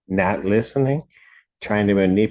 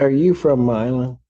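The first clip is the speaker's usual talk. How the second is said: ordinary talk, nothing marked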